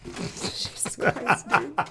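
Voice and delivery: breathy, helpless laughter through the words; soft, wheezy laughing exclamation. Real, not acted. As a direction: crack up while protesting, stretched out. Cracking up at partner's interruption.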